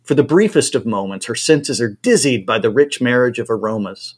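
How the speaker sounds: plain reading voice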